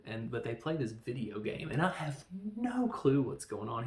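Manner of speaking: ordinary talk, nothing marked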